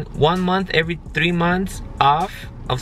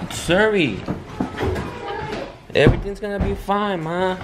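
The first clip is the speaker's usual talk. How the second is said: soothing, higher-pitched comfort; gentle reassurance; 6 semitones higher than usual. Real, not acted. Parent consoles child sent to principal, high gentle reassurance.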